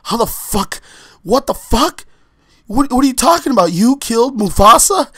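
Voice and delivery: high, loud shocked shout; shocked yell. Retold or imitated. Nephew yelling at uncle Scar, shocked high shout. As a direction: blurt it out in disbelief.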